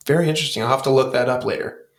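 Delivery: usual speaking voice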